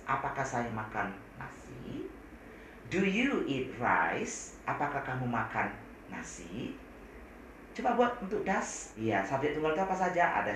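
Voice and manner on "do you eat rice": very slow, careful English; slow model sentence. Shown as a demonstration. Teacher slowly models the question. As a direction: spell out the example slowly for students. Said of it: "teacher models example".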